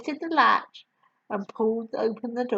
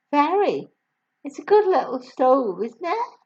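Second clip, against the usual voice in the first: put-on higher, louder gentle young woman; kind teacher voice; 5 semitones higher, 4 dB louder than usual. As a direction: warm young teacher reassuring. Miss Honey voiced.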